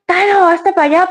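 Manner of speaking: nervous shooing. Retold or imitated. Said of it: "me agarra y".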